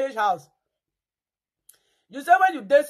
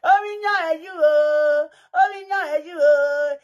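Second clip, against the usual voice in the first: mock wailing; 2 semitones higher, 4 dB louder, much slower than usual. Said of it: "this one dey cry".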